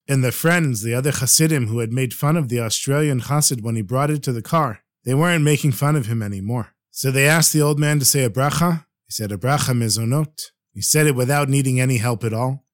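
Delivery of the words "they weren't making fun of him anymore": ordinary delivery, nothing marked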